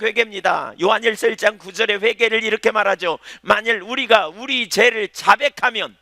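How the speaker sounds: high, loud pulpit delivery; fervent sermon voice; full-force preaching. Acted.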